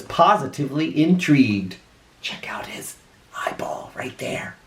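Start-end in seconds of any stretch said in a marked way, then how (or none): 2.2-4.6 hushed aside whisper. Real, not acted. Reader to a listening child, whispering. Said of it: "whisper heard".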